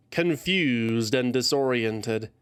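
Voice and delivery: ordinary delivery, nothing marked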